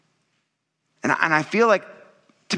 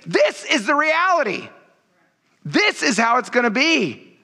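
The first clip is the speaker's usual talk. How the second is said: high, slow, urgent sermon voice; preaching at full force; 11 semitones higher than usual. Acted. Preacher voicing God to the people, urgent and high. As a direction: drive it home like a prophet's warning.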